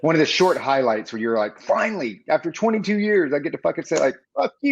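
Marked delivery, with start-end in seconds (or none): 1.6-4.7 mock triumph. Veteran finally free to talk back, mock triumph. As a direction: act out a long-awaited payoff, voice climbing. Retold triumph after twenty-two years.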